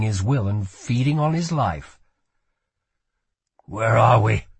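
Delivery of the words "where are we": hoarse, louder question; strained, hoarse voice. A narrator's reading. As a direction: rasp out the question with effort. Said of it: "his voice hoarse".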